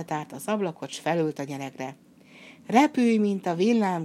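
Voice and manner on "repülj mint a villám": shouted spell. Acted. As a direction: spell shouted out loud and high. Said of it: "kiáltotta".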